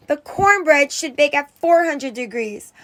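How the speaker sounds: usual speaking voice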